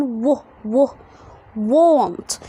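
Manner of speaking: "و، و، want" sounded out with an o vowel. Shown as a demonstration. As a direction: build "want" from wo to wont. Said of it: "فخلي ال A هنا يتنطق O". (تهجي want بنطق الـA صوت O)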